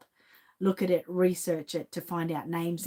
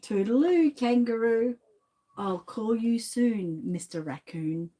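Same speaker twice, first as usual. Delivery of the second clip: sing-song rhyme reading; 3 semitones higher, slower than usual. Acted. Reading rhyming story lines.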